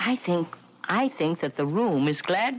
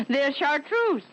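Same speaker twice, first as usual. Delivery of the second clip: high, indignant insistence; incredulous correction; 8 semitones higher than usual. Acted. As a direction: set him straight, amazed.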